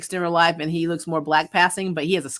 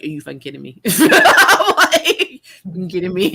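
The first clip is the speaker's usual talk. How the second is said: mock outrage breaking into laughter; incredulous, through laughs; laughing disbelief; 9 dB louder than usual. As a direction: re-enact disbelief while cracking up. Retells disbelief at DNA result, laughing.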